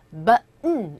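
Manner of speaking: BUTTON with the T swallowed into N; native swallowed T. Shown as a demonstration. Teacher shows how natives say it, BUTTON with no released T. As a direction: cut BUTTON short after the vowel, then nasal N. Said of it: "버튼이라고 원어민들은 발음을 안 하고요".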